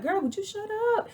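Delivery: high, rushed exasperated cry; exasperated outburst. Retold or imitated. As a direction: blurt it out high and fast, fed up. Her exasperated thought at Drew.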